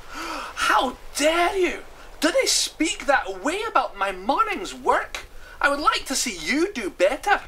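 high, loud, drawn-out outrage; outraged high-pitched character voice; indignant. Acted. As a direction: protest as if deeply insulted. Hamish indignant at the insult.

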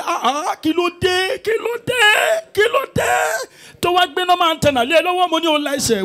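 high, loud crying yell; mock wailing shout. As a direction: wail it like a pastor in anguish. Pastor's mock anguished cry.

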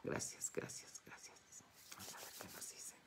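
soft whispered thanks; hushed, fast whisper before drawing a card. Acted.

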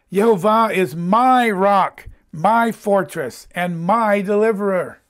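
loud, slow "my" before each title; stress on MY. Acted. Preacher reading David's song to show it is personal, "my" heavily stressed. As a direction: lean hard on every "my".

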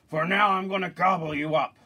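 troll voice. Acted. Troll threatening the second goat, loud menacing voice. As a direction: roar the threat like a hungry troll. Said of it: "said the troll".

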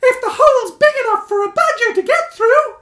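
high, loud squeak with a laugh; bubbling with the discovery; growing excitement. Acted. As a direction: Stuart thinking it through aloud. Stuart working it out.

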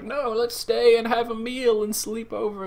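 high, soft, drawn-out insistence; imitated old friend gently coaxing; lightly voiced Charles. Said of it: "he's like".

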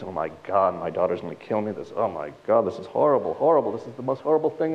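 muttered, anguished prayer gathering strength; dismayed grandmother. Acted. Grandmother's prayer as boy is lost.

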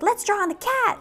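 high-pitched mischievous mouse voice; excited little mouse. Acted. Mouse plotting against sleeping kitty, excited squeaky voice. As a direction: pipe up high as a scheming mouse. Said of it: "and the mousie's like".

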